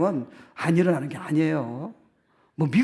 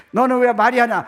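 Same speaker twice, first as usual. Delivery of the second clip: nagging parent; 8 semitones higher, 8 dB louder than usual. Retold or imitated. Parent scolding child.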